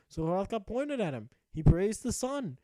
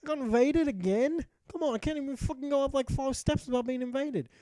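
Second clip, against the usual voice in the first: higher, fast indignant outburst; exasperated Hrothgar; 7 semitones higher, 3 dB louder than usual. Retold or imitated. Knight Hrothgar invaded again on the stairs, high exasperated outburst. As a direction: squawk it up high, fed up. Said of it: "Hrothgar was like".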